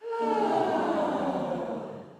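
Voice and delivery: high, long sighing release; a long, high voiced sigh. Shown as a demonstration. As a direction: let it all out in a sigh. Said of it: "we're just gonna sigh".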